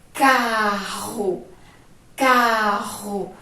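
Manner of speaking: "carro" with a strong, drawn-out throaty double R; strong, long R. Shown as a demonstration. Teacher showing pupils the doubled R, "carro" strong and prolonged. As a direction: stretch out "carro", the RR forceful and guttural. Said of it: "O som é forte e demora mais".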